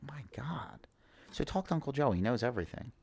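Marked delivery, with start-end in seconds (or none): 0.0-0.9 breathless awed whisper. Retold or imitated. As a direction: whisper it, floored. Stunned reaction to advice.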